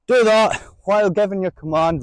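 ordinary talk, nothing marked

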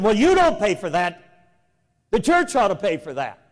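usual speaking voice

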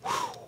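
high, airy exhaled whew; heavy whew. Real, not acted. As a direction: let out a whew at the weight of it. Reacts to weighty scripture.

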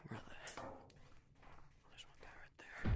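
hushed whisper while hiding; sneaky whisper. Real, not acted. Hiding from other players, a sneaky whisper. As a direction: whisper so no one hears.

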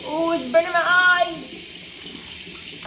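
slow, loud pained whine; pained cry. Real, not acted. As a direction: cry out as eyes sting. Cleanser in eyes.

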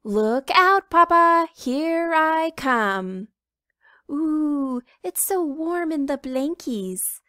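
high, loud chick voice, slow on here I come; a bouncy chick diving into bed; little red chicken. Acted. Little chicken jumping into Papa's bed, high and loud.

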